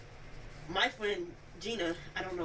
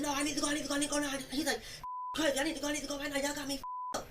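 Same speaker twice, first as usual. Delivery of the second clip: higher-pitched agitated mimicry; retold urgent insistence; 6 semitones higher, faster than usual. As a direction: imitate him insisting on leaving. Retold insistent demand to leave.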